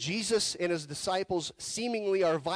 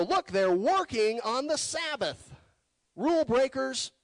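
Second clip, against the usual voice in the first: higher, tattling Pharisee voice; mock Pharisee accusation; 7 semitones higher, 5 dB louder than usual. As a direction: point the finger like a tattletale.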